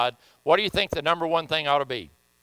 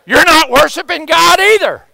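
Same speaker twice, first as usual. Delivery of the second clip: much higher and louder, hammering the point home; rebuking shout; 9 semitones higher, 12 dB louder than usual. Real, not acted. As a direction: shout it at the crowd like a warning. Preacher rebuking the congregation.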